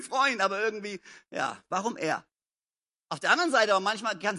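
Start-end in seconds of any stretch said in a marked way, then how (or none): none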